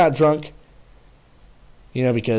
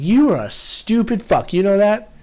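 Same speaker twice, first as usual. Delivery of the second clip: higher, slow scornful mock scolding; imitating first sergeant; 8 semitones higher than usual. First sergeant scolding the corporal, slow high mock scorn. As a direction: drag out the insult, pitched up.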